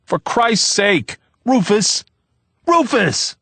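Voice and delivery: high, loud, slow shout of his name. A narrator's reading. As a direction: shout to snap him out of it. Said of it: "Vivaldo shouted".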